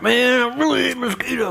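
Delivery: high, exaggerated complaint; exasperated. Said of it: "I'm like".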